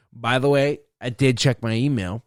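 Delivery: ordinary delivery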